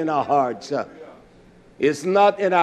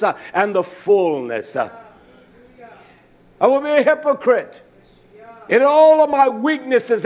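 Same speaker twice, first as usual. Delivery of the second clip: driving, emphatic sermon delivery; raised, insistent pulpit voice; full-force preaching; 7 semitones higher, 4 dB louder than usual. Real, not acted. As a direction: preach it hard, hammering each repeated phrase.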